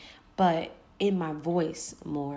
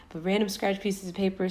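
ordinary delivery

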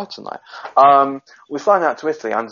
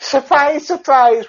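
much higher mock TV host catchphrase squeal; 4 dB louder, slower than usual. Host mimicking a TV presenter for his friends, high mock squeal. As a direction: belt it like a gameshow host springing a surprise. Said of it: "She Welsh".

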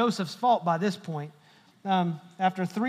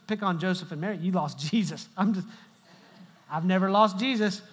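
ordinary talk

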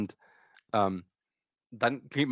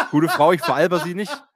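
louder, laughing protest; exasperated; 3 semitones higher, 7 dB louder than usual. Retold or imitated. Patient telling the receptionist he's not joking, exasperated and laughing. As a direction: insist you're not joking, half laughing. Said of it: "Ich so".

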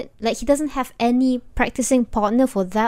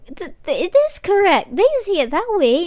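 excited insistence; 8 semitones higher, 6 dB louder than usual. Retold or imitated. Child arguing with parents, retold.

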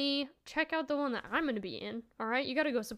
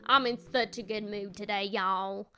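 put-on Southern accent; 3 semitones lower than usual. Shown as a demonstration. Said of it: "Someone said that this voice".

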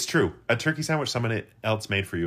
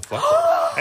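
high squeaky; 9 dB louder than usual. Acted. Self as fluffer, high squeal.

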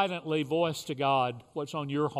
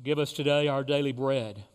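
leading the Lord's Prayer with long pauses; short phrases with pauses; 6 semitones lower than usual. Real, not acted. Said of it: "I will say a phrase".

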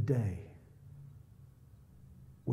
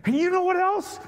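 much higher, louder yell; neighbor yelling; 10 dB louder than usual. Retold or imitated. Neighbor charging up the drive, high loud yell. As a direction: shout it as he comes at you.

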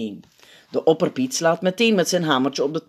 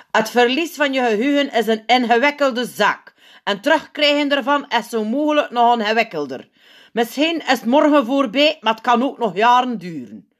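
higher-pitched grave doctor figure; zenuwpiet, ernstig; 8 semitones higher, 5 dB louder than usual. A narrator's reading. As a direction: announce the diagnosis to the hall. (ernstige, hogere zenuwpiet)

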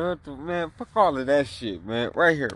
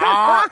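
loud imitated scream of pain; mock scream; 3 semitones higher, 9 dB louder than usual. Joker imitating a man screaming mid-sex, mock scream. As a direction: cry out loudly, acting the victim. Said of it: "it ain't her screaming".

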